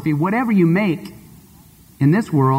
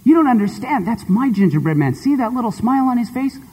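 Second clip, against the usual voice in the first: put-on higher, insistent little girl; much higher girl voice, pleading; 7 semitones higher than usual. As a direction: beg the shopkeeper for him. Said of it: "she says".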